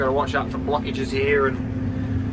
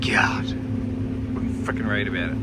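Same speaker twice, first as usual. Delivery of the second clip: under-breath mutter; 6 semitones lower, 4 dB quieter than usual. Real, not acted. Muttering frustration to himself.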